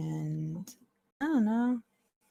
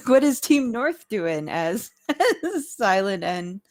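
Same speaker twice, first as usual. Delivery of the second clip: laughing; 5 semitones higher, 7 dB louder than usual. Real, not acted. GM laughs while asking.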